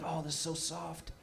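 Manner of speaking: very soft, marvelling murmur; hushed awe. Acted. Boy getting to touch one for real, hushed wonder. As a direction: savour it in a hush.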